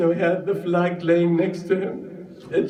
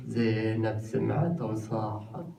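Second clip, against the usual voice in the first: quiet aside; 6 semitones lower, 7 dB quieter, faster than usual. Real, not acted.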